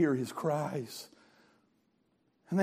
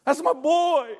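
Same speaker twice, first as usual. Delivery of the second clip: loud, high, anguished cry for his son; 10 semitones higher, 9 dB louder, slower than usual. Acted. Grieving father crying out for his trapped son, anguished loud cry. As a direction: let the father's grief burst out loud.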